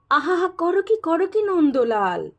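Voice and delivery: high-pitched cry of protest; alarmed crowd. A narrator's reading. Everyone exclaims at Nandalal's vow, mock alarm.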